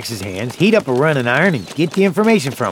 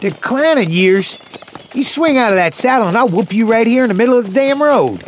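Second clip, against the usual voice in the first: indignant outburst; 7 semitones higher than usual. Acted. Old partner stung by age jibe, indignant. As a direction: pitch jumping up in outrage.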